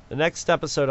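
ordinary talk, nothing marked

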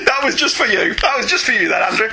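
laughing. Real, not acted. Laughing after acting out joke.

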